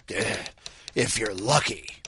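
low, soft undead grumble; zombie voice. A narrator's reading. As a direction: shrug it off like the undead. Zombie answers the priest.

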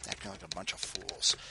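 low muttered grumble; muttered aside. Acted. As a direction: mutter it under your breath.

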